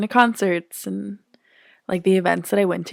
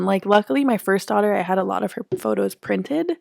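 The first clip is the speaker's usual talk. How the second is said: ordinary delivery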